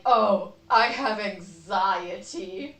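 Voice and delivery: slow, dismissive mock of a casual anxiety claim. Mocking people who casually say they have anxiety, slow and dismissive. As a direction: drawl it out like someone who doesn't get it. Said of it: "And I don't mean like".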